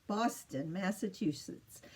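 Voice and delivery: Massachusetts run together quickly and softly; said fast. Shown as a demonstration. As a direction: rattle off Massachusetts in one quick go. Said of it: "if you say it fast".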